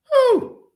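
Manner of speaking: high exhaled "whew". Real, not acted.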